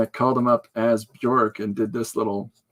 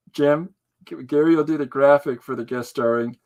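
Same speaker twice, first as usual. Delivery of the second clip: ordinary talk, nothing marked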